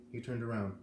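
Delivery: plain reading voice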